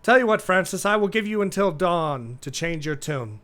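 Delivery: loud, high, cold royal threat; furious ultimatum. Acted. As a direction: give him until dawn, seething. King gives ultimatum angrily.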